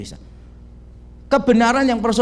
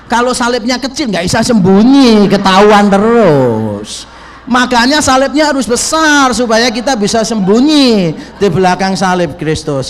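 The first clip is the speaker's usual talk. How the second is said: loud joke; 3 semitones higher, 11 dB louder than usual. Real, not acted. Joking sermon punchline, loud.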